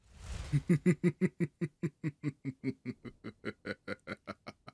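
long, high, loud cackle tapering down; villain's drawn-out staccato laugh; triumphant evil laugh. Acted. Demon finally freed, triumphant evil laugh.

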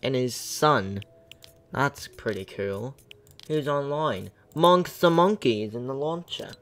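a streamer's mock British accent, lightly done; playful fake British accent for the chat; put-on British accent. Gamer greeting his stream chat in a mock British accent. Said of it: "stop talking like a British ac-, in a British accent".

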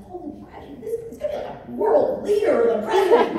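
high-pitched awestruck gushing, building to loud; retold amazement. Expectant mother telling husband about the baby, amazed. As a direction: start hushed and high, swell to a big proclamation.

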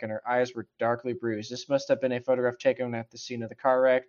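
normal talking voice

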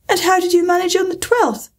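high-pitched, keen questioning; eager. A narrator's reading. Alice asks how they managed on the twelfth, eager. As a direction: ask it eagerly, pitch lifted. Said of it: "Alice went on eagerly".

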